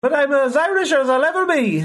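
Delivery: put-on a hopeful stab at an Irish accent; mock Irish accent. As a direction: give it your best Irish, knowing it's bad. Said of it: "was that good?".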